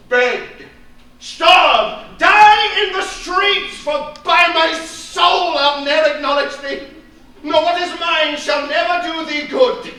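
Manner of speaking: father threatening to disown his daughter; rising into a furious shout. Acted. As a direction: build to a roar.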